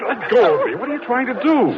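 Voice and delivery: alarmed shout. Acted. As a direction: yell to shake her off. Woman grabs him in car.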